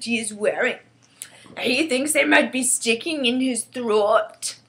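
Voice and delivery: loud, relishing giant voice; emphatic giant passing on gossip; chatty friendly giant. Acted. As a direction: report it as a simple-spoken giant, savouring odd words. BFG explains further.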